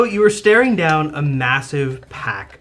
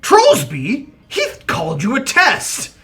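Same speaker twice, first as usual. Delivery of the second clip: tattling troll; 8 semitones higher, 5 dB louder than usual. Acted. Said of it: "responds to him and says".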